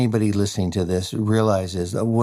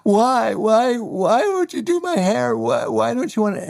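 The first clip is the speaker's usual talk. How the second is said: tearful pleading; 13 semitones higher than usual. Retold or imitated. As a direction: whine it as if in tears. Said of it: "in tears ... and saying".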